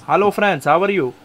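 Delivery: high, hammed-up Indian accent; comic Indian-accented hello; funny Indian accent. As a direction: greet them in an exaggerated Indian accent. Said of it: "cut with that funny accent".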